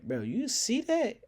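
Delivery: high, eager friend pointing something out; hyped whisper-call. Retold or imitated. Friend nudging the narrator about the girl, high and eager. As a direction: nudge him, eager and high.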